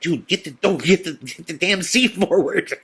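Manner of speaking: high, stumbling panicked urging; imitated freaking out. Frankie ordering his friend to move the seat, freaking out. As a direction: trip over the words in mock panic. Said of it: "he's, like, freaking out".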